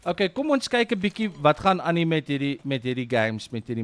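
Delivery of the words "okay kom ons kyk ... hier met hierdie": ordinary delivery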